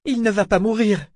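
high, anxious insistence; desperate denial; seeking reassurance. A narrator's reading. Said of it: "Elle cherchait à être rassurée".

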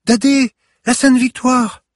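put-on high, loud child's outcry; urgent child. A narrator's reading. Granddaughter reminds grandfather of Sainte-Victoire, crying out urgently.

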